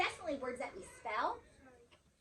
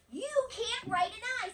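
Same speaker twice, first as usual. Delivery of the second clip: higher, louder, you can't write an I; emphatic rule; 8 semitones higher, 7 dB louder than usual. Shown as a demonstration. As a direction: punch it like a rule. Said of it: "teacher models the rule".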